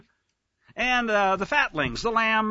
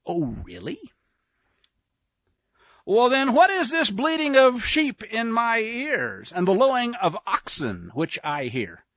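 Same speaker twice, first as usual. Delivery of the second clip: sarcastic; 3 semitones higher than usual. Acted. Samuel questioning Saul about the sheep, sarcastically. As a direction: feign innocent puzzlement, dripping sarcasm. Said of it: "God love the sarcasm".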